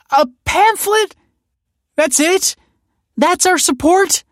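very high, loud, incredulous outcry; shrilling upset mother, loud and drawn out; distressed cry. A narrator's reading. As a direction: cry out in outraged disbelief. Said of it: "cried the mother".

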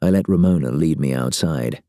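usual speaking voice

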